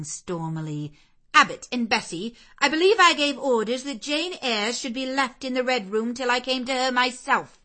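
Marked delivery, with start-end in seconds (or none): 1.3-7.6 loud, higher, cold rebuke; stern mistress. Acted. Mrs Reed rebuking Bessie and Abbott, stern and cold. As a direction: put the servants in their place.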